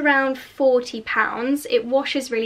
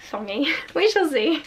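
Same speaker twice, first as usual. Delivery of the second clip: ordinary talk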